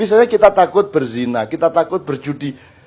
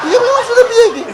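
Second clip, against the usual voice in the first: squeaky mock voice; 4 dB louder, faster than usual. Acting out a flustered patient.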